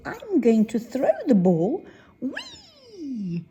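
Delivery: loud eager call rising to a high whee; a little bear about to throw; excited. Acted. Bear cub tells everyone, excitedly throwing the ball. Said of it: "and he says".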